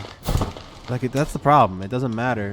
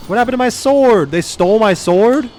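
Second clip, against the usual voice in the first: loud, higher shocked outburst; outraged disbelief; 13 semitones higher, 12 dB louder than usual. Real, not acted. Player finds his artifact sword gone, loud shocked disbelief. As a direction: blurt it in stunned outrage.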